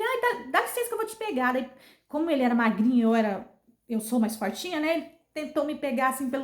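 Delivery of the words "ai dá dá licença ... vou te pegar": high-pitched, flustered pastor; imitated desperate helper, voice pitched high. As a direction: reenact a panicked offer of help. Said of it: "Esse homem ficou desesperado".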